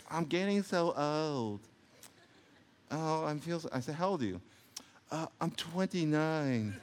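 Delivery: soft, slow, mock whiny voice; mock complaint.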